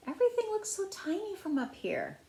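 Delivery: put-on high, soft little-girl voice; lightly voiced girl gazing down from a pretend plane. A narrator's reading. Penny voiced high and wondering in her pretend plane.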